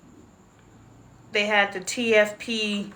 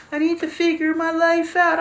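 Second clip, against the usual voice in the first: mimicking daughter; 8 semitones higher, 4 dB louder than usual. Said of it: "Adoy's like".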